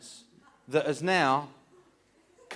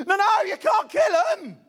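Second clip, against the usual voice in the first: loud, very high hammed-up yell; panicked protest; 9 dB louder than usual. Son-in-law stopping boot over spider, high panicked shout. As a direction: cry out desperately to stop him. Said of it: "and I go".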